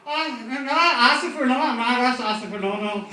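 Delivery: put-on high loud slurred drunk pleading; high, loud, slurred drunk denial. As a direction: drunk begging the officer, slurring.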